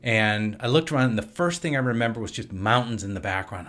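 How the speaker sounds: ordinary talk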